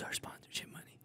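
unvoiced conspiratorial whisper. Real, not acted. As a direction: whisper it behind a hand. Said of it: "Why are you whispering?".

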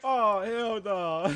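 slow laughing hell no; laughing disbelief. Real, not acted. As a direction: drag it out while laughing.

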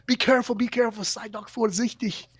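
high, anxious bartender pleading to be careful; worried bartender. Retold or imitated. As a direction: voice the fretting barman warning him off. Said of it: "the bartender's going".

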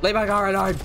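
loud shout at attackers; real angry shout; yell. Real, not acted. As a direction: bark it out to protect the car. Yelling at attackers in game.

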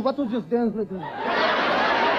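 slow, laid-back demo of the vato dance; cool vato dance. Shown as a demonstration. Comic shows the crowd how vatos dance, slow and cool. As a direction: drag it out, too cool to hurry.

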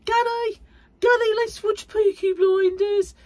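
very high, loud, hammed-up Peaky Blinders accent; overemphasized terrible accent. Teasing partner Gary, in an overemphasized Peaky Blinders accent. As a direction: squeal it in a wildly exaggerated bad accent. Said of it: "I overemphasize it on purpose".

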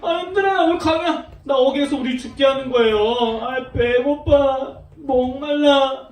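hammed-up grumble of a hungry, tired crowd; whiny grumbling. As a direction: whine it out, dragging the last words. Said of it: "막 짜증내고 불평하고 막 원망했어요".

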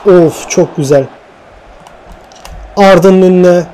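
usual speaking voice

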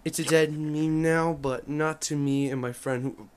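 normal talking voice

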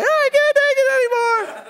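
high, hammed-up whiny wail with a laugh; mock tantrum. Jesus mimicking the preacher's meltdown, hammed-up whine. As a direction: wail it like a kid throwing a fit. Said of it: "You started kicking and screaming".

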